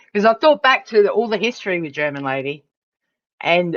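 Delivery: ordinary talk, nothing marked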